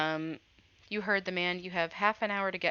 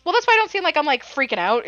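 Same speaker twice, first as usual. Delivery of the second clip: defensive outburst; 10 semitones higher, 11 dB louder, faster than usual. Real, not acted. Player defending calm reaction.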